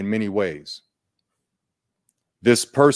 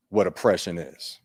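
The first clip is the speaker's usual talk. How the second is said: ordinary talk, nothing marked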